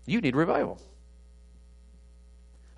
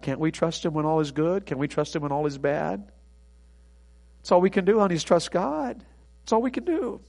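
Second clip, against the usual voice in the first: gentle husband; 6 dB quieter than usual. Pretend. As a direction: comfort a grieving wife softly. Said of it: "it was with gentleness".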